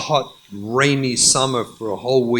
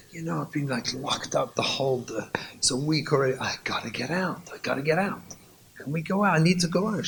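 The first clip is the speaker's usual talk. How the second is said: much softer, almost whispered plea; soft pleading wife; 7 dB quieter, much faster than usual. Acted. Wife softly begging her husband to go out, much softer. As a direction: ask him gently, worn out.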